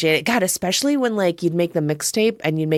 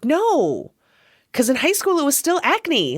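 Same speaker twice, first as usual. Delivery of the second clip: streamer's retort; 7 semitones higher than usual. Retold or imitated. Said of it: "his response was like".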